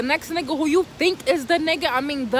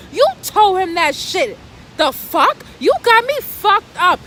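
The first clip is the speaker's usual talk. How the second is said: higher and louder, going off on him; furious rant; 5 semitones higher, 6 dB louder than usual. Pretend. Cussing out her man over the phone, furious. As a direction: let him have it, loud and high. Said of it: "that's what I really wanted to say".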